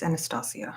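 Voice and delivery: Anastasia said an-uh-STAH-see-uh, falling and soft; "ah" pronunciation. Shown as a demonstration. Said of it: "Or is it Anastasia?".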